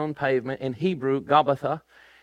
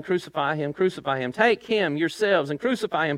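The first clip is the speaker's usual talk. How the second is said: ordinary delivery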